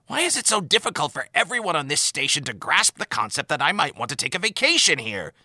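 fed up with nobody believing him; exasperated outburst. Acted. Admiral complaining to Sisko about his vacation, exasperated outburst. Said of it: "The Admiral heaved".

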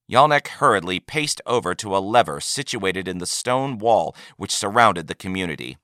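ordinary delivery

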